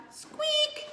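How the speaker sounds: very high tiny squeak; hammed-up mouse squeak. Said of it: "said Mouse".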